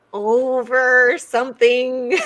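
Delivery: slow, drawn-out witch voice, laughing; witchy voice. Shown as a demonstration. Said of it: "you kinda have to do it with a witchy voice".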